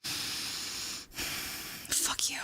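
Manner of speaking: whispering. Real, not acted. Whispered after being scared.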